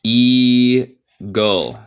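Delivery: "eagle" in two slow parts, long loud EE then GUL; stretched pronunciation model. Shown as a demonstration. Teacher showing learners how eagle sounds, stretched out. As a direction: draw out the first vowel of "eagle". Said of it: "La pronunciación de eagle suena como".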